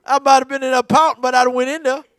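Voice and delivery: ordinary talk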